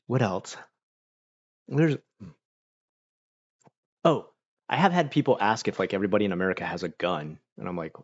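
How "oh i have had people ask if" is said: ordinary talk, nothing marked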